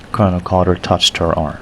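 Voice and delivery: usual speaking voice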